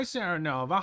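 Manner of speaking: ordinary talk, nothing marked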